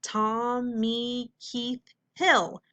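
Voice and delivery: Tommy Keith Hill very slowly in four beats; slow, evenly spaced TOM-MEE-KEITH-HILL; syllable by syllable. Shown as a demonstration. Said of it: "That's four syllables".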